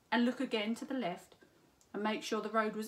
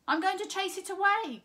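higher, louder determined call; 10 semitones higher, 7 dB louder than usual. A narrator's reading. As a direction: shout it back, determined. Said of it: "called Pussy Simpkin".

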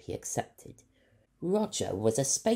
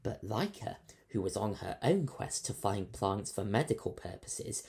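ordinary delivery, nothing marked

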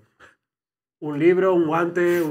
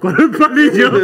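laughing; 5 semitones higher, 7 dB louder, slower than usual. Real, not acted. Laughing exclaim.

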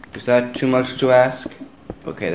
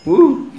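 high, loud "woo!"; relieved whoop; 13 semitones higher, 6 dB louder than usual. Real, not acted. Gamer who nearly died, high relieved whoop.

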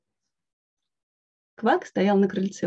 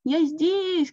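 calling out; 8 semitones higher than usual. A narrator's reading. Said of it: "крикнул Квак".

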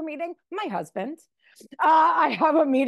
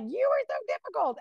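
mimicking mother; 10 semitones higher than usual. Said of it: "my mother's like".

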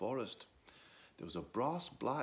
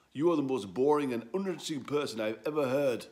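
donkey voice; 5 semitones higher, 8 dB louder, faster than usual. Acted. Donkey insults Edwo.

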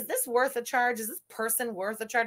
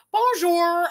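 put-on Ontario English accent; 7 semitones higher, 7 dB louder than usual. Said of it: "that Ontario English accent".

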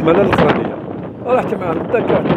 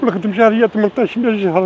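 ordinary talk, nothing marked

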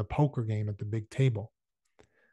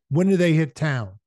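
higher, louder demand with a crackle; sharp, clear; 4 semitones higher, 8 dB louder than usual. A narrator's reading. Shane cutting off Johnson before the room, sharp and clear. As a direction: take charge with a sharp, louder question. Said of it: "His voice was sharp and clear".

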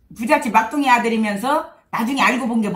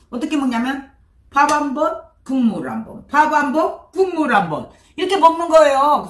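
talking between spoonfuls of soup; speaking while eating rice; mouth full, chewing; 6 semitones higher, 4 dB louder than usual. Real, not acted. Seen 2: Spoons soup and rice to her mouth between words, then talks.

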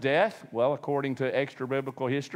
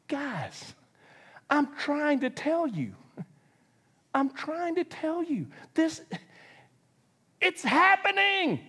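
slow, raised pleading patience; pleading Jesus; 11 semitones higher, slower than usual. Retold or imitated. Jesus pleading with squabbling disciples, slow and raised. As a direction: plead gently with exasperated disciples. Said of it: "he's like".

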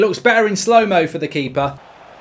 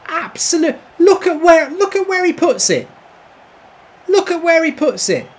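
outraged disbelief; 9 semitones higher, 3 dB louder than usual. Real, not acted. Gamer reacting to a ridiculous finish against him, high outraged disbelief. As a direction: protest in a high, stretched voice. Disbelief after conceding a goal.

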